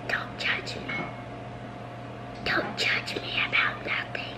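hushed whisper to the camera. Real, not acted. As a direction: whisper so she won't hear.

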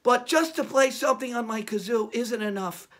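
ordinary talk

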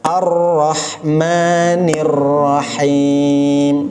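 Ar-Rahmanir with the ro held and made heavy; Ar-Rahmanir recited slowly with a heavy, held ro; thickened ro. Shown as a demonstration. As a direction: stretch Ar-Rahmanir Rahim slowly, ro deliberately thick. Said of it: "saya sengaja ro-nya agak ditebalkan".

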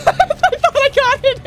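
high and slow through laughter; giggling I thought I got it; laughing disbelief. Real, not acted. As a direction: laugh it out, can't believe it missed. Laughing at failed throw.

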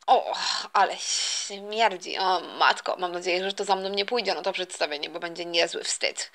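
low-pitched talking to himself; embarrassed aside. Acted. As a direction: drop the pitch for a private, embarrassed remark. Son mutters to himself in toilet.

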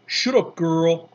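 loud raspy retort; rough, loud shout to silence her; rasping. A narrator's reading. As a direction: rasp it out, annoyed. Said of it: "A voice came rasping from the fence".

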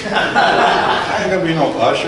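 scoffing through a laugh. Retold or imitated. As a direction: laugh off the idea in disbelief. Retelling his scoff at pastor.

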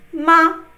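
Ma short and light; neutral tone ma. Shown as a demonstration. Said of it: "So ready?".

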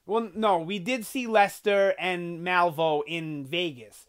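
ordinary talk

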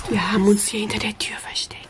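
hushed whisper behind the door; whisper, we must keep quiet. Real, not acted. Hiding behind the door with kids.

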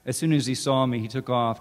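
ordinary delivery, nothing marked

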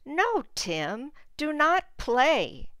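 a hammed-up stern mother for children; scolding mom. Mom scolds Tim in story.

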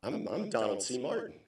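soft, halting admission; sheepish confession. Retold or imitated. Mistaken celebrity confessing to the fan, sheepish and soft. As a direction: stammer it out softly.

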